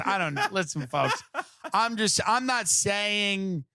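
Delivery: ordinary talk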